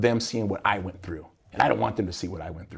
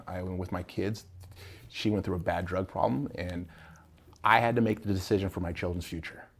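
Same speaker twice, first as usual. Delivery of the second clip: ordinary talk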